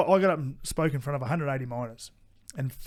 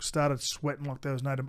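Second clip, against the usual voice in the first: normal talking voice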